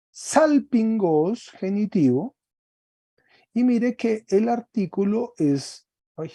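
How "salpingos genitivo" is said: salpingos stretched out slowly; slow dictation. Shown as a demonstration. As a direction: spell salpingos out for the class. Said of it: "queda salpingos". (salpingos, lento y marcado)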